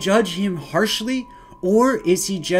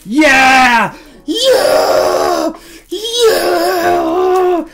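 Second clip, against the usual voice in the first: high, loud drawn-out whoops; hyped yelling; 10 semitones higher, 6 dB louder, much slower than usual. Acted. Streamer unleashing his prop for chat, hyped yelling. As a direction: yell it out like a triumphant reveal.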